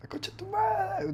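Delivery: very high-pitched cursing wail; screamed curse. Real, not acted. Animator cursing at the software while working, high scream. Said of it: "all the times that I scream".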